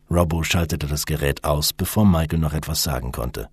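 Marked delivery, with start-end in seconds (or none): none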